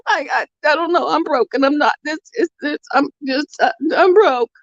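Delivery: high, tearful, voice cracking with sobs; retelling breaking down in tears to the doctor. Retold or imitated. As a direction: relive the moment of falling apart crying. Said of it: "I started bawling".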